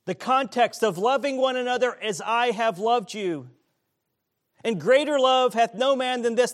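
loud, high preaching. Acted. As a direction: preach it with full force. Preaching at full force.

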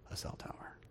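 hushed, voiceless reveal; whispered. Retold or imitated. Friend telling his pastor about the windfall, whispered. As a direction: drop to a whisper for the astonishing detail. Friend's astonishing news, retold.

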